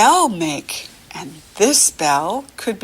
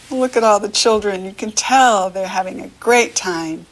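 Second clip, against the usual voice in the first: usual speaking voice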